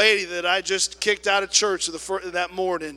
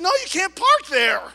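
high, loud, laughing retort; laughing blunt no; 13 semitones higher, 9 dB louder than usual. Retold or imitated. Attendant laughingly answers the lady's dare. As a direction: blurt it out with a laugh.

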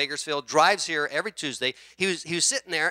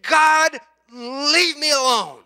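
loud, higher, slow mock defiance; retold cry at God; 9 semitones higher, 9 dB louder, much slower than usual. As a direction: shout it up at the sky. Retelling man shaking fist at God.

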